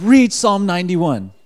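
emphatic acted-out command; insistent command. Inner voice answers the shouted protest.

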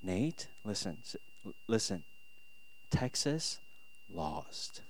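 soft, halting, low voice; hushed, careful dad breaking bad news; gently breaking news. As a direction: let a small child down gently, pausing often. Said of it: "how do I gently let him down?".

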